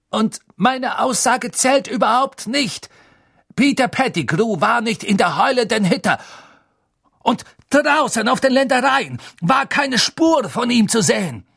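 high, loud, emphatic rant; shouted indignation; furious outburst. A narrator's reading. Snape outraged his testimony is ignored.

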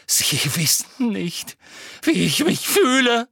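high, loud bellow; anguished furious bellow; Harry bellowing. Acted. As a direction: roar it at the old man. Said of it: "brüllte Harry".

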